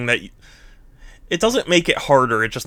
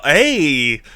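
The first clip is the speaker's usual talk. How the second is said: normal talking voice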